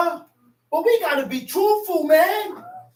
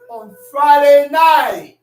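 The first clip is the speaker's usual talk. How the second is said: on Friday night slow and loud; drawn-out shouted Friday night; slow preaching shout; 10 dB louder, slower than usual. Acted. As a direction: hammer each word of it out. Preacher hammering the phrase.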